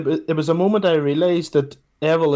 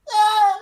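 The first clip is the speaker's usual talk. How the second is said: high wordless cry; high-pitched mock squeal; mock shout. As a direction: imitate her blurting out a noise. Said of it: "just shout it out".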